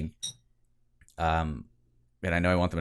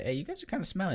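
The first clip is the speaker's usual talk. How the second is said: NPC voice; 13 semitones higher, 5 dB quieter, faster than usual. Pretend. GM voicing NPC to players.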